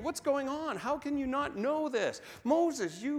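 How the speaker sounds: getting mad. Acted. Israelites complaining to Moses at the sea, building hammed-up anger. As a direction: whine at Moses, climbing into outrage. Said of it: "They're getting mad. They're saying".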